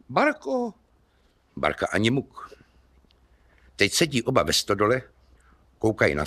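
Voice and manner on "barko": volání. Acted. Kubát calls the woman.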